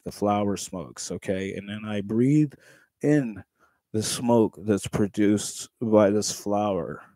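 slow, low, dreamy explanation; mock stoner lecture. Breaking down stoning for chat, slow and low. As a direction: explain smoking like a stoned professor.

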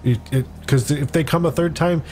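ordinary talk, nothing marked

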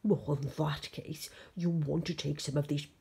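low, emphatic monster voice; monster Betty. Acted. Monster offers George bones for the Yeti, low creature voice.

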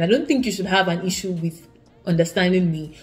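ordinary talk, nothing marked

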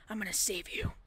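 soft unvoiced whisper; hushed vow whispered to Grandma. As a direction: breathe the promise to Grandma.

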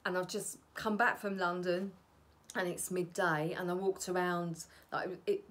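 ordinary talk, nothing marked

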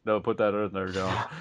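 deep, lightly voiced lady; low mock woman's voice; man doing a woman's voice. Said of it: "Your lady voice also got super deep".

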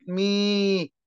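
مي as a long, held "me". Shown as a demonstration. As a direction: draw out the "ee" of مي. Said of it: "بمد في الإي". (مدّ الإي في «مي»)

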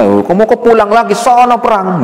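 high, shooing mother; scolding mother. Acted. Mother sends her son back to war, high and scolding. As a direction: push the son back out the door. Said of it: "Begitu sama orang tuanya".

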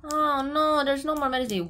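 sudden realization, lightly wailed; acted oh-no moment; dismayed boy. Boy finds medicine all gone.

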